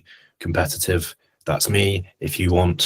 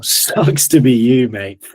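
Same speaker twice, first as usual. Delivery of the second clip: slow, louder, laughing gloat; mock taunt; 3 semitones higher, 7 dB louder, slower than usual. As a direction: rub it in playfully. Teasing listeners who missed deal.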